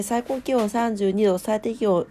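ordinary delivery

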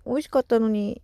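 ordinary delivery

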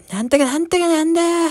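put-on squeaky drunk woman's babble; imitating the auntie. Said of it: "そのおばさんが". (甲高いおばさん声の真似)

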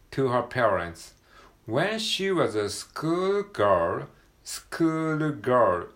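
teacher reading aloud; very slow model reading. Shown as a demonstration. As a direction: stretch each word out. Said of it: "女子学生ですね".

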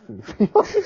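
laughing apology. Real, not acted.

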